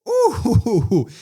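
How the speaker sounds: high, loud hooting ooh; impressed "ooh". Real, not acted. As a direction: hoot like a crowd after a burn. Reacts to the dramatic comeback line.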